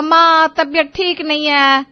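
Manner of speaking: a lightly voiced whiny son, slow; mocking the son. Mother mimics her son's excuse, a hint of whiny mockery. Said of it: "अब तू कहेगा".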